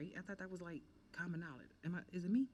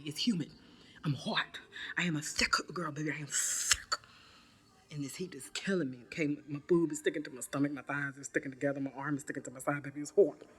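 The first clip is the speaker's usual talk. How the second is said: loud heat complaint; 6 dB louder than usual. Real, not acted.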